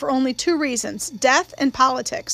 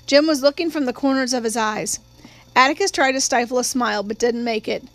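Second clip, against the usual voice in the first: ordinary delivery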